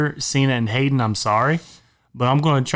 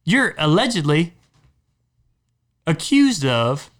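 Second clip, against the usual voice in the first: outraged rant; 8 semitones higher than usual. Real, not acted. Outraged rant about the will.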